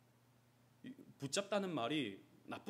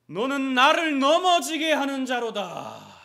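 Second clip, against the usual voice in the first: much higher, louder, slow thundering rebuke; Jesus rebuking Peter; 11 semitones higher, 19 dB louder, slower than usual. Acted. Jesus rebuking Peter, slow thundering rebuke. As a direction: thunder each word at him. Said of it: "예수님께서 베드로를 꾸짖으신 거죠".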